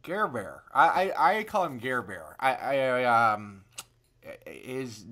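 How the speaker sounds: ordinary talk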